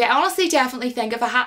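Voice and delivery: usual speaking voice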